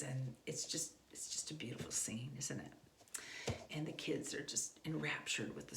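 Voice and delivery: soft breathy awe, fading to a whisper; hushed dreamy whisper. Pretend. Parent describing the cozy read-aloud scene, whispered dreamily.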